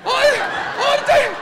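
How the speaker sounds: put-on very high-pitched child's squeal; frantic excited kids. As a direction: shriek and beg like overexcited children. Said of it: "jump up and they're like".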